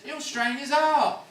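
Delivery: high, loud shrill cry of a fussing lady; mock fussy owner. Mimicking the woman whose dog ran off, loud and high. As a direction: squeal it like a panicked dog owner. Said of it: "she says".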